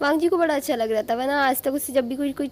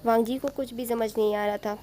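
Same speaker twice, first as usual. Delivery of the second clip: ordinary delivery, nothing marked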